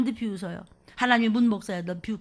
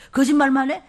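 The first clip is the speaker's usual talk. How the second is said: loud rebuke; 9 dB louder than usual. Real, not acted.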